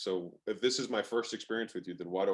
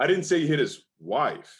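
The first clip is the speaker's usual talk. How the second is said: didnt punched loud and high; stress on DIDN'T; 6 semitones higher, 8 dB louder than usual. Shown as a demonstration. Coach demoing stress on didnt to the host. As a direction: hit the word didnt hard. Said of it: "a quick and fun example".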